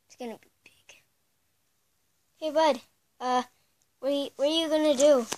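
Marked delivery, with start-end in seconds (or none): 0.1-1.0 breathy unvoiced whisper. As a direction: whisper to yourself. Whispered play aside.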